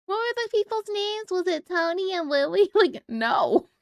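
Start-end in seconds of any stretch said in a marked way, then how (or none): 0.0-2.7 high-pitched imitation of a confused questioner; mock clueless asker. Streamer mimicking someone unsure of the Titanic names, mock clueless.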